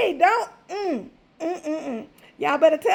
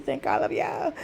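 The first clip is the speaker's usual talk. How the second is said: whispered thanks; 8 dB quieter than usual. Real, not acted. Repeated whispered.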